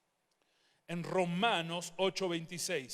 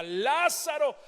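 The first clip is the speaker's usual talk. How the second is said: called out higher and louder; 7 semitones higher, 7 dB louder, slower than usual. Acted. Jesus standing and shouting for Lázaro, loud call. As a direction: cry the name across the tomb. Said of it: "se levanta y grita".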